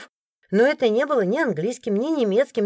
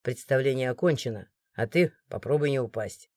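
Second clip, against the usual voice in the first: much lower, softer stern question; cold authority, no need to shout; stern guard; 8 semitones lower, 6 dB quieter than usual. A narrator's reading. The guard questioning the crowd, low stern voice. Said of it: "приказал стражник".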